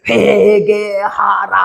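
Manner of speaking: hugely drawn-out open-mouthed syllables; very slow, stretched pronouncement; slow, mouth wide. Retold or imitated. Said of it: "이리 벌리면서 천천히 말을 하는데요".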